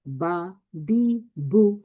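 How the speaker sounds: very slow drawn out बा बी बू; short vowel drill. Shown as a demonstration. Teacher chants बा बी बू slowly for students. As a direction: chant बा बी बू, each one stretched. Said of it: "इस फर्क को समझिए".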